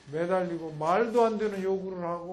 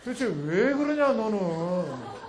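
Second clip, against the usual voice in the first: exasperated mother; 3 semitones higher than usual. Acted.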